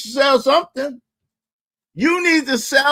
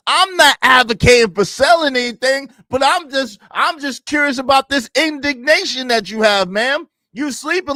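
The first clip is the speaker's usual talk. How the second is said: ordinary talk, nothing marked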